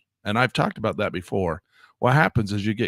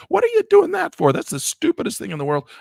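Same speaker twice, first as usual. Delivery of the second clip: very high, louder mock outburst; hammed-up disgusted shriek; mock outrage; 5 dB louder than usual. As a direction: act out scolding bad software. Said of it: "I was like".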